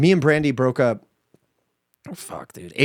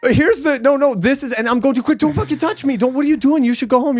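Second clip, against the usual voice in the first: high-pitched mock panic, pushing him away; high frantic imitation; mock freak-out; 11 semitones higher than usual. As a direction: squeal it like you're shooing off a germ. Retelling shouting at sick friend.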